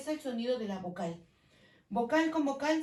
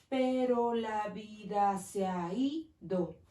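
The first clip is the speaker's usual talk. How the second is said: slow syllable count; much slower than usual. Shown as a demonstration. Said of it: "aquí se están uniendo".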